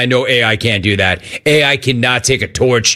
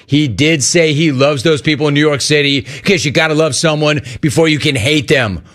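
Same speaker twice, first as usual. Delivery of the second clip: ordinary talk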